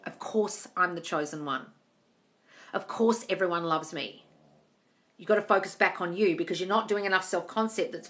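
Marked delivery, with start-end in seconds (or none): 0.0-4.2 slow confident affirmation. Shown as a demonstration. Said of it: "maybe I just stop saying".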